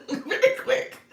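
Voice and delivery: very quick spoken slowly through a high laugh. Real, not acted. Coaching her audience, says very quick while laughing. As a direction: giggle out very quick, drawn out.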